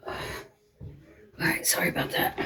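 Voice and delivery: whispered apology. Real, not acted. Back from letting the dog out, whispered apology. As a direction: whisper sorry to the viewers.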